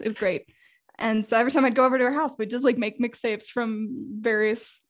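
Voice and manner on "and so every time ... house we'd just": ordinary talk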